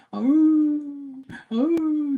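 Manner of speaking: very high whimpering dog whine; imitating crying dogs. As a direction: mimic the dogs whining at the door. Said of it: "like the dogs are crying".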